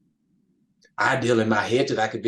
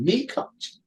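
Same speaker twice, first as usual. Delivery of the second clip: astonished disbelief; 13 semitones higher than usual. Retold or imitated. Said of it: "and I'm going".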